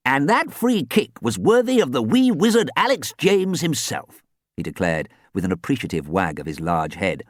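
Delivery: lightly voiced old gentleman; a hint of a fussy old coach. Archie the ghost coach speaks.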